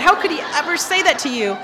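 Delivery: hammed-up indignation at a high pitch; retold outrage. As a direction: act out an upset husband, half amused. Husband outraged at the priest.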